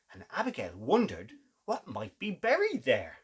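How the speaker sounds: gentle storybook narration with big gasps; a storyteller drawing little listeners in; reading to children. Acted. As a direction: read it like bedtime story time.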